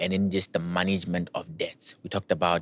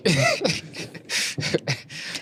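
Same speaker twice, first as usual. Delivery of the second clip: high, airy burst of laughter; 9 semitones higher than usual. Real, not acted. Host asking the expert to repeat slowly, laughing.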